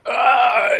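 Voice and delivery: loud, high "ah" yell; pained yell. Acted. Player's character strains in quicksand, yelling out. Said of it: "Trenton yells out".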